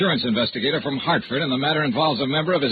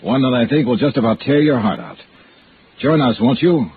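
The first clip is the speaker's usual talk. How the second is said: louder, punchy promo read; radio promo; 5 dB louder than usual. Acted. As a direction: sell next week's show to the listeners. Star promotes next week's episode.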